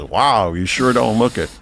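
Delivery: ordinary talk, nothing marked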